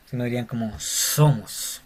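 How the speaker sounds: ordinary delivery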